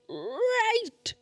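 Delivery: 1 squeaky great. Real, not acted. High sarcastic great after films.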